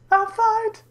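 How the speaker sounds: squeaky high voice crack. Real, not acted. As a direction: voice jumps way up. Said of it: "Sounded weird".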